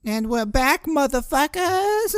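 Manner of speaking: loud, high, stretched shout-out; screeching welcome back; hyped comeback. Real, not acted. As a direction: belt it like a wild show host. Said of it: "show return announcement".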